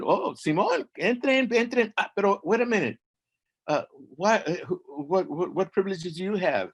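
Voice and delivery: high, eager gatekeeper welcoming then suspicious; a high, chatty doorman who suddenly turns wary; sing-song guard of the underworld. Acted. Gatekeeper of the dead.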